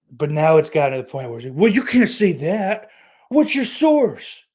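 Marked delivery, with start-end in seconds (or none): 1.6-4.5 much higher and louder, whiny mock voice; sneering impression of a pedantic host; mocking whiny scold. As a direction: ham up a prissy fact-checker, slowing down on the last words. Mocking Joe's nitpicking of comedians.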